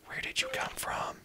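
breathless high whisper; startled whisper. Real, not acted.

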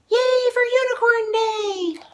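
very high, loud cheer; joyful cheer. A narrator's reading. Author ending the story for kids, cheering. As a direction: end the book with a big yay.

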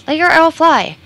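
high, loud desperate shout. Acted. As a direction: scream the order at him. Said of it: "she growled".

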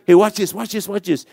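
high, excited repeated urging; eager. Retold or imitated. God eagerly shows off to the angel.

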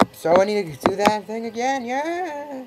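high, loud cheer ending in a drawn-out yeah; enthusiastic. Real, not acted. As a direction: burst out with high-pitched enthusiasm. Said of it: "You can sense my enthusiasm".

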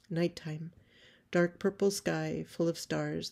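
normal talking voice

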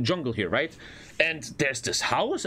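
ordinary delivery

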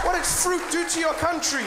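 high, incredulous comic cry; exasperated outrage. Acted. Comedian challenging Australia over fruit, exasperated.

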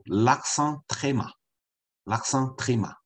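deliberate French classroom modelling; pronunciation demo. Shown as a demonstration. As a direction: say "l'accent très mat" slowly for learners to copy. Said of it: "不知道怎么念".

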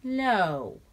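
put-on girl refusing the giraffe her donut; solemn refusal. A narrator's reading. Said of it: "Then Lindy said solemnly".

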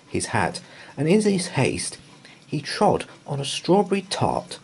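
plain reading voice

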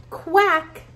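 a duck calling out in a storybook; quacking duck. Acted. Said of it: "said the duck".

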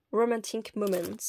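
hammed-up English accent; English pronunciation put on for fun; playful English accent. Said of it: "avec mon superbe accent anglais".

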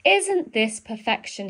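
ordinary delivery, nothing marked

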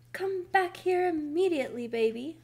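storybook shout, commanding; bossy shout. A narrator's reading. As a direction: call your sister back sternly. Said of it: "shouted Eugenia".

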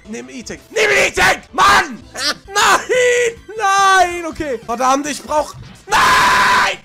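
ordinary talk

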